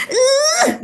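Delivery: put-on frustrated child grunt. Teacher imitating an angry child's frustrated grunt. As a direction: grunt like a fed-up little kid.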